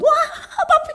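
put-on very high, loud child's shriek; hammed-up child's squeal of terror; panicked small child. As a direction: scream for daddy like a frightened kid. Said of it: "anak kecil kan juga sama laba-laba gitu".

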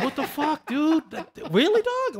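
way up high, squeaky disbelief; incredulous. Retold or imitated. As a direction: shriek it in stunned disbelief. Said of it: "I'm like".

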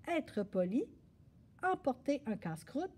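plain reading voice

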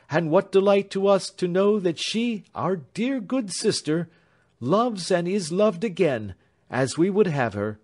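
a devoted sister praising Grace; fond younger sister. A narrator's reading.

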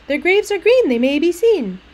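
high, loud nursery-rhyme bounce; super sing-songy. Shown as a demonstration. Teacher showing the childish rhyme, super sing-songy. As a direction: recite it like Doctor Seuss. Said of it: "So it's super sing-songy, like Doctor Seuss".

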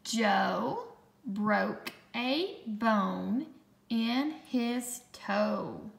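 slow, word-by-word dictation; slow, clear dictation voice; dictation pace. Shown as a demonstration. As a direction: read it out slowly for spelling. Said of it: "let's check your work".

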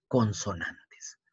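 soft slow whisper of the word; whispered term. Shown as a demonstration. Teacher whispering the new word to the pupil, slow and soft. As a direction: breathe the word out for the class.

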